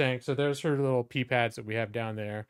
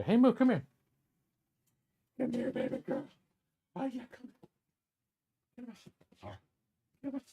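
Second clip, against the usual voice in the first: much higher sing-song come here to the puppy; high coaxing call; 5 semitones higher, 8 dB quieter than usual. Real, not acted. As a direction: coax the dog over sweetly.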